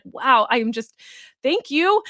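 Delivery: mock gushing client; gushing thanks. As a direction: imitate the client's delighted gratitude.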